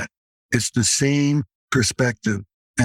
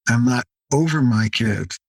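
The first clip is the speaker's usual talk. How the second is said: normal talking voice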